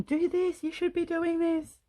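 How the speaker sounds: high mock yell of viewers; lightly voiced shouting audience; mock screaming viewers. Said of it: "you're all screaming at me going".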